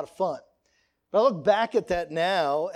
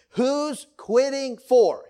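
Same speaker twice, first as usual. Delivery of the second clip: higher, louder, slow call-out; dad's loud question; 7 semitones higher, 5 dB louder, much slower than usual. Retold or imitated. Father quizzing his kids on the way to school, loud slow call. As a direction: call it out to the kids in the back seat. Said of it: "He would pose the question".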